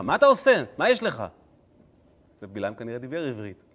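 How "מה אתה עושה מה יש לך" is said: high, loud scolding yell. Acted. Donkey yelling at Balaam in Hebrew, scolding yell. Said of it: "זה שהיא צועקת עליו".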